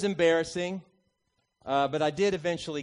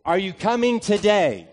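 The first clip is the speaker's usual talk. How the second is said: impatient coach; 5 semitones higher, 9 dB louder than usual. Retold or imitated. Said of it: "and he says".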